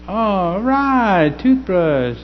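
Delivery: drawn-out, high-pitched underwhelmed reaction; feigned gratitude. Acted. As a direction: stretch it out high, politely faking delight at a dud gift. Acting out reaction to dull gift.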